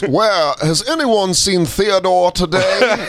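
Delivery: slow, stately father voice; hammed-up patriarch; plantation-owner dad. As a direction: drawl it out like the master of the house. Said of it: "it starts with his dad".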